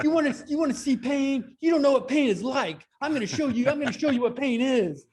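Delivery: high, loud mock cop threat; threatening cop. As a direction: ham up a furious cop threatening pain.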